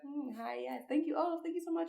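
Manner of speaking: high, sweet little thank-yous; put-on high-pitched receptive voice; flirty interested reply. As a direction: coo it high, acting charmed. Acting out how she responds when interested.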